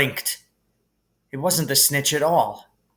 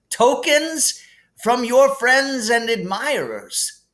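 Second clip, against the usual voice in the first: higher, gently amused headmaster voice; kindly old headmaster; 11 semitones higher, 5 dB louder, slower than usual. Acted. Dumbledore calming Harry in the hospital wing, gently amused. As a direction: soothe the boy like a kindly wise old wizard.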